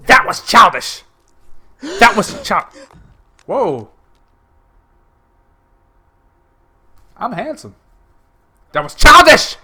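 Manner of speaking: high, loud rattled outburst; flustered outcry; shaken exclamation. Real, not acted. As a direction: shake off the scare loudly. Reacting to scare.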